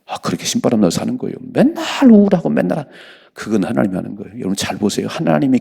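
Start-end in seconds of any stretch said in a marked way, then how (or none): 1.4-2.9 high mocking whine; sudden high mock of the grumbler; mock gloomy whine. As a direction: pitch it high like a sulky complainer. Mimics gloomy complainer.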